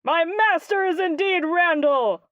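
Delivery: pompous, emphatic guardian; proud proclamation. Acted. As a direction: grandly declare it.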